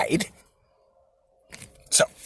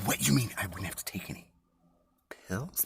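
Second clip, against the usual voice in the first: normal talking voice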